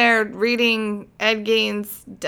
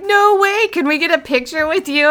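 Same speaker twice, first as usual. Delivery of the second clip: much higher, louder fan gushing; excited fan; 8 semitones higher, 4 dB louder than usual. Retold or imitated. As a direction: gush like a thrilled stranger. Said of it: "He's like".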